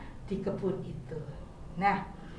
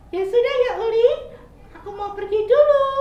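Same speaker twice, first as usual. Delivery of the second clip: much higher, louder, caring little squirrel; kind squirrel; 10 semitones higher, 8 dB louder, faster than usual. Acted. Squirrel asking crying caterpillar what's wrong, high caring voice. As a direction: sound like a friendly squirrel asking gently.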